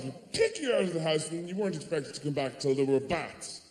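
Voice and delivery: ordinary talk, nothing marked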